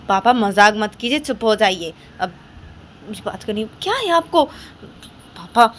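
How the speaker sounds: loud, annoyed; angry scolding. Acted. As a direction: tell father to stop joking. Daughter scolds father before call. (झुँझलाकर ज़ोर से डाँटना)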